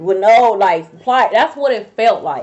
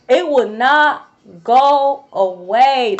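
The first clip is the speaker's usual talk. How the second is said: "It would not go away" at half speed, each word stretched; exasperated emphasis; 4 dB louder, much slower than usual. Real, not acted. Telling viewers the pain persisted, slow exasperated stress on "not go away". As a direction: lean slowly and hard on "not go away".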